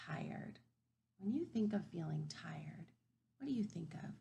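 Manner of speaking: hushed, breathy whisper. Shown as a demonstration. Teacher whispering to kids about feeling tired, hushed. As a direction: whisper it softly to the class.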